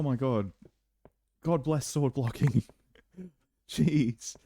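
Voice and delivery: usual speaking voice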